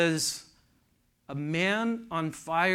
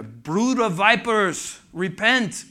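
loud, high, drawn-out Scottish preacher; imitated Scottish pulpit cry, ringing out; Alistair Begg; 4 semitones higher, 6 dB louder, slower than usual. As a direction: thunder it out slowly in a Scottish accent. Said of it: "he said it in that Scottish accent".

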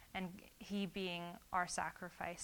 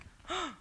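sharp, high gasp of fright; startled gasp; 9 semitones higher than usual. Retold or imitated. Caught laughing by the priest, a startled gasp.

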